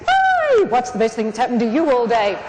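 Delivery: energy and vitality. Shown as a demonstration. Coaching the audience to greet their neighbour, full of vitality. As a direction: launch the greeting with a squeal. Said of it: "with real energy and vitality".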